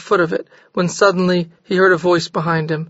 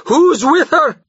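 much higher, louder repeat; louder cry; 10 semitones higher, 4 dB louder, slower than usual. A narrator's reading. Jorgis repeating his demand, crying out. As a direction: push the question harder. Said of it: "he cried again".

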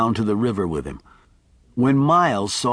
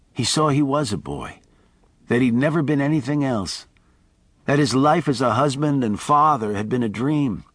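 ordinary delivery

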